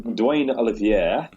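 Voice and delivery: "Dwayne Olivier" drawn out slowly in a South African accent. Shown as a demonstration. Host trying the bowler's name the South African way, slow accent. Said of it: "I think that's more like it".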